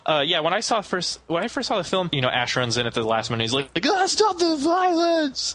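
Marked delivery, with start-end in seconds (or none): 3.8-5.5 high, drawn-out heroic cry; hammed-up plea, pitched way up; Ash. Host imitating Ash's last-minute dash, mock heroic cry.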